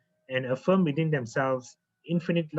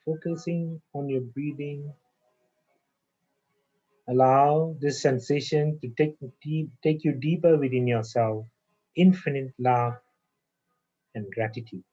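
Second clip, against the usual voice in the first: guided meditation. Acted. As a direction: breathe them down into calm, one phrase at a time. Leads a guided breathing meditation.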